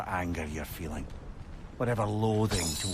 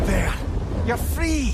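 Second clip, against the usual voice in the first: loud, high cry of release; shouting to the freed creature; triumphant shout; 8 dB louder, slower than usual. Acted. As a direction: yell it as the chain breaks.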